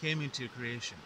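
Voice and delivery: dramatic legend narration; myth teller. A narrator's reading. Myth retold, higher and louder.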